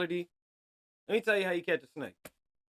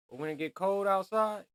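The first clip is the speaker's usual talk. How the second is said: ordinary delivery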